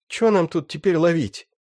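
high-pitched rough bandit; young thug voice. Acted. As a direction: whine it like a wounded gangster. Раненый бандит. (высокий голос молодого бандита)

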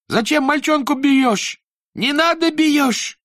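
put-on high, loud, foreign-sounding boy's yell; indignant shout. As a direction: yell in protest at the teacher. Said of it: "закричал".

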